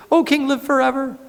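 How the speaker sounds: ceremonial greeting. Acted. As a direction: hail the king like a courtier. Officials greet the king.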